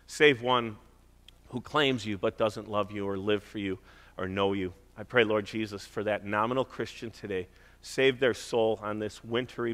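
soft, low pleading prayer; earnest pastoral prayer. Acted. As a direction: pray over the room, hushed and earnest. Leads congregation in prayer.

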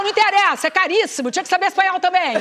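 mais alto e agudo, indignada. Retold or imitated. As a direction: reclama do preço da escola, exaltada. Mônica indignada com a escola. (mãe indignada com a escola cara)

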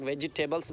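"वेजिटेबल्स" with every syllable sounded, ve-ji-te-bals; wrong pronunciation. Shown as a demonstration. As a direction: put a full "ji" inside "वेजिटेबल्स". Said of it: "ये wrong pronunciation है". ("वेजिटेबल्स" का गलत उच्चारण, "जि" पूरा बोलकर)

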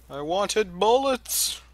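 whiny complaint. Pretend. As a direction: drag it out like a sulky kid. Whines for more bullets.

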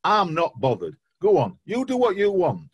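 hammed-up huff at the departing manager; mock indignant rant. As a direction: pretend scorned fan, loud and petulant. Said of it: "is that better".

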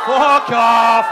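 a furious dad roaring fuck off; furious shout. Acted. Bellowed at daughter's date.

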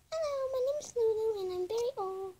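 high squeaky pretend voice, almost crying; a high wobbly old toy. Child voicing an old toy, high and squeaky.

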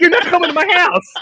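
high, loud, laughing refusal; imitating fed-up rocker. Rocker refusing a fan's visit, retold as laughing indignation. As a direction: shut the caller down in mock outrage.

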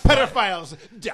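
high, loud laughing shout; shouting orders over battle. Acted. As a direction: shout the call again, laughing. Repeating battle call.

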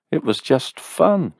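brushing it off amiably. A narrator's reading. A man brushing off his ex, lightly amiable. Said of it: "he told her amiably".

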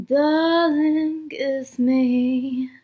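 ordinary delivery

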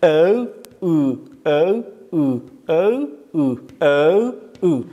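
slow stretched vowel او; teacher drilling the vowel او; drawn-out oo. Shown as a demonstration. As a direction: sing out او long.

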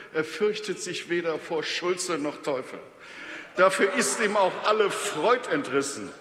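mock-heroic declamation of a rhymed poem; verse recitation. Speaker mocks the opposition's motion in Faust-like rhymes, declamatory verse. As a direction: declaim each couplet, lifting the openings and letting line ends fall.

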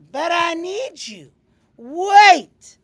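high-pitched plea rising to a loud call; desperate pleading. Acted. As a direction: beg her to stay, pitched high. Lizard pleads as she leaves.

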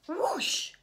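high loud whoosh. A narrator's reading. The scary thing fleeing, a loud high whoosh. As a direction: blast it out. Monster flees.

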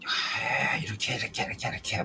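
a lightly voiced meddling spirit, teasing; mocking spirit voice. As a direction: egg him on in a needling voice. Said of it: "걔가 막".

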